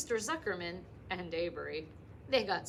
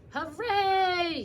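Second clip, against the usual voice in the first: high, loud cheer; crowd yelling; 11 semitones higher, 9 dB louder than usual. Acted. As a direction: cheer out as the pig rises. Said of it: "yelled the crowd".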